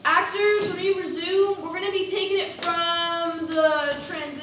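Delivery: loud call across the theatre. Real, not acted. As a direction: announce it to the whole cast. Stage manager calling to cast.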